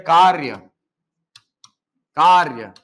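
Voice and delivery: कार्य said slowly with the र sound before य; demonstrated pronunciation. Shown as a demonstration. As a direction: model कार्य clearly as kaar-ya. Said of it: "जैसे आप बोलते हैं".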